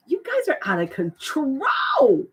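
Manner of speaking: slow, choked-up happy exclaim; nearly crying with joy; overwhelmed delight. Real, not acted. Overwhelmed by gifted memberships.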